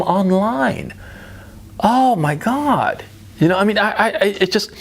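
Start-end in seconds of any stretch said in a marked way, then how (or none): 1.8-3.0 disgusted. Real, not acted. Disgusted reaction to crying interview.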